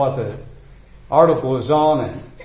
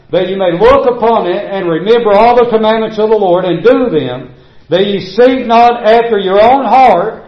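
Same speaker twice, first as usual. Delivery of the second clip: full-force preaching; 4 semitones higher, 5 dB louder than usual. Acted. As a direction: hammer the verses home to the church.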